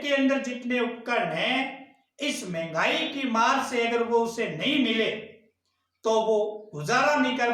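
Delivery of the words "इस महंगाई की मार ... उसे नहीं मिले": ordinary talk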